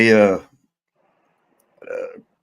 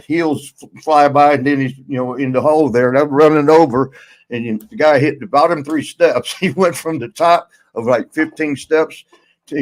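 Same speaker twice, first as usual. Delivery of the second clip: ordinary talk, nothing marked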